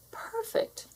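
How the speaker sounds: breathy whispered aside; smug little whisper. Acted. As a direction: breathe the word out like a secret compliment. Listing own perfect features.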